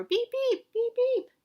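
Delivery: very high, loud robot beeps; high-pitched mechanical beeping, loud. Acted. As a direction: beep like an excited little robot. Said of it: "do you think the robot would say".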